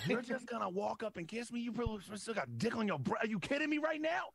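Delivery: high-pitched incredulous rant; indignant retort. Retold or imitated. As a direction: act out outraged disbelief at the nerve of it. Acted confrontation with girlfriend.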